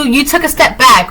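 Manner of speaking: ordinary delivery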